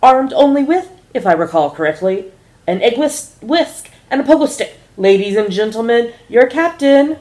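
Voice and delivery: first officer introducing the captain, rising to a grand, loud finish; airline announcer, building. Acted. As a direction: build the introduction to a big flourish. Douglas announcing to passengers.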